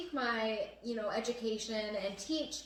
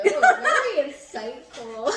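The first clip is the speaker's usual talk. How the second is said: "Very insightful" drawn out slowly, much louder, laughing; mock praise; 4 semitones higher, 13 dB louder, much slower than usual. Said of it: "Ooh, look at that".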